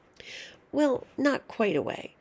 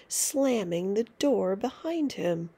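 ordinary delivery, nothing marked

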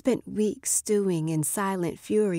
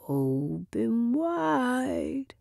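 slow, higher teasing singsong; sing-song; 4 semitones higher, much slower than usual. Acted. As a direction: stretch it out like a taunting tune. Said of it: "he sing-songed".

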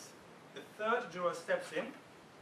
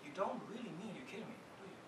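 softer, calm, a touch taunting; very calmly; 9 dB quieter, faster than usual. Retold or imitated. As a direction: answer the threat coolly and quietly. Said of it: "replies very calmly".

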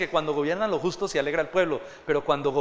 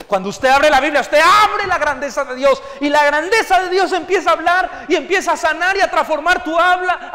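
loud, high fervent preaching; impassioned pulpit preaching; preaching at full force; 9 semitones higher, 8 dB louder than usual. Acted. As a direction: build the crowd up, fervent. Preaching climax.

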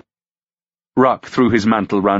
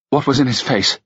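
sharp; 7 semitones higher than usual. Acted. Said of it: "she asked sharply".

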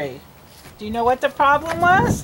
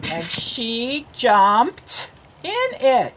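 very slow, word by word; slow model reading; much slower than usual. Shown as a demonstration. Teacher reads the line for a child to copy, very slowly. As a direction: draw out each word for a beginning reader. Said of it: "You read it".

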